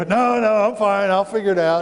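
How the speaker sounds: put-on kid dodging chores. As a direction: brush the parent off quickly, like a kid. Kid dodging parent's chore offer.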